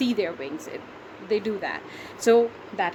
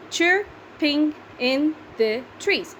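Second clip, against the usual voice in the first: slow, raised beats on "Chirping in the trees"; syllable by syllable; 4 semitones higher, much slower than usual. Shown as a demonstration. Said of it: "So altogether".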